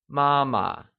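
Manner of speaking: "mama" with a high, level first syllable. Shown as a demonstration. Teacher models the Mandarin word for mother, "mama" in high level tone. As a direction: keep the start of "mama" level. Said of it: "pronounced using the high level tone".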